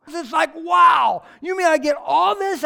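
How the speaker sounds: high, loud amazed wow; excited wow. Retold or imitated. Believer amazed at grace, a loud excited wow. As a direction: burst out in amazement.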